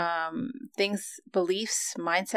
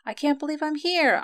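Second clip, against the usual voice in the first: excited; 9 semitones higher, 5 dB louder than usual. Retold or imitated. Swings of energy.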